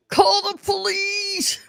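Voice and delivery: high frantic yell; panicked call for help; shouting. Retold or imitated. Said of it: "Jules was like".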